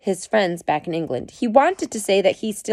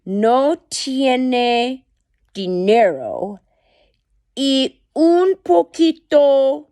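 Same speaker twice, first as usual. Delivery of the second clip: Spanish retelling; 6 semitones higher, 5 dB louder, much slower than usual. Shown as a demonstration. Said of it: "so for your English speakers".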